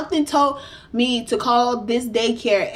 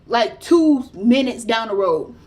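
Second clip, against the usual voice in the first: ordinary talk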